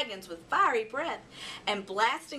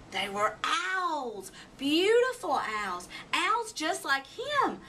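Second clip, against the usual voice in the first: slow and rising, full of wonder; 5 semitones higher, slower than usual. A narrator's reading. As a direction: marvel at them slowly.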